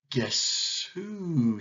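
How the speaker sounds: lightly voiced, drawn-out snake; sly python. A narrator's reading. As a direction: stretch it out teasingly like a snake. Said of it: "said Python".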